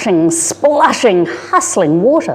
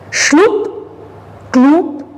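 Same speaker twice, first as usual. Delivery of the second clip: a higher, playful sucking-mud noise; squelching mud sound; 9 semitones higher than usual. Acted. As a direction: make the mud slurp. Hooves sinking in mud.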